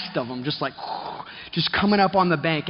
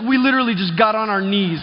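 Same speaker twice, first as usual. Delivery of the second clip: ordinary talk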